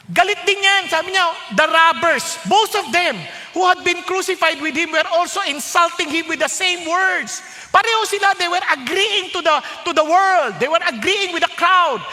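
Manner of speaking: high, loud preaching; fervent preaching. Acted. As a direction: drive the point home loudly. Preaching at full force.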